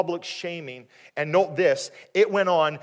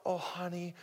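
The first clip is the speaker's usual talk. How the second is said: soft, higher, consoling coo; tender husband, rejected reading; 3 semitones higher, 10 dB quieter than usual. Acted. Husband gently consoling his weeping wife, tender. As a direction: gently comfort her, much softer. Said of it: "it wasn't that".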